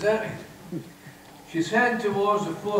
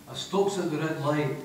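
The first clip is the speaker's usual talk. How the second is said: plain reading voice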